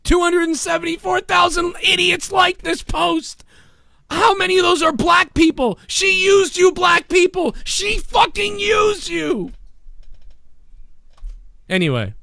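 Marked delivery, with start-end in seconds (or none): 0.0-9.5 high shouting mock celebrity voice; hammed-up mock of the actress virtue signalling; mocking impression. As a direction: ham up a shrill shallow star. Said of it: "except I'm gonna use her".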